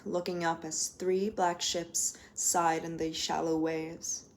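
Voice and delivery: measured, expressive poetry recital; poem reading. Acted. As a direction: savour each image like a performance.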